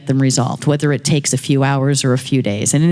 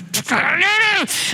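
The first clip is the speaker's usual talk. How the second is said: high wordless squeal of frustration; mock frustrated squeal. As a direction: squeal wordlessly, imitating your own outburst. Mimics herself getting louder.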